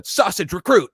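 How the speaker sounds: high, loud boot camp bark; recruit's shouted call. Retold or imitated. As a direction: sound off like a recruit in the chow line. Acting out chow line call.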